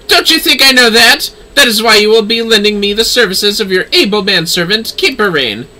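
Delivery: indignant lady. Acted. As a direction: snap back at being doubted. Lady snaps back, louder.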